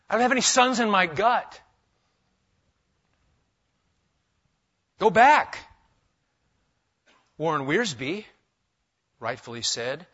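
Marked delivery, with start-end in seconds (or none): 0.1-5.6 loud, higher scolding; rebuke. Shown as a demonstration. Naomi telling daughters-in-law go back, loud rebuke. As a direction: push them away, firm and loud. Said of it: "Not quite as nice".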